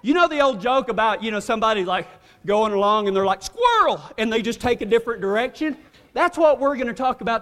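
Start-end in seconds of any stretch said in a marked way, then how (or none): 3.4-4.0 high-pitched excited outburst; sudden distraction. Retold or imitated. Acts out someone suddenly distracted.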